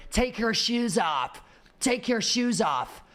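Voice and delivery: slow, mocking American security guard barking orders; hammed-up American accent, dragged out; imitating angry American. To airport travellers, a mock angry American guard. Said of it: "an angry American man ... going".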